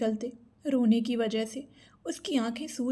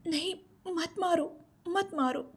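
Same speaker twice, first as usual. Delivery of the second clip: high, slow pleading, no real scream; pained cry; 7 semitones higher than usual.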